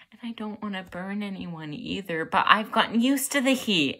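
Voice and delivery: building distress. Acted. Student afraid of burning anyone, distressed and building. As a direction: build from hushed confession to overwhelmed outburst.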